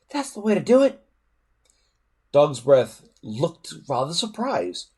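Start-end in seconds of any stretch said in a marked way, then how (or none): 0.1-1.0 high, eager praise; cheering. A narrator's reading. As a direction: egg your friend on. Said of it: "cheered Fishlegs".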